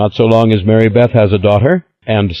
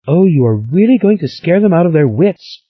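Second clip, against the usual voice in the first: high, thrilled exclamation; excited cry; 11 semitones higher than usual. A narrator's reading. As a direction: cry out with glee. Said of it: "cried Stella".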